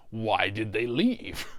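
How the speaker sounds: normal talking voice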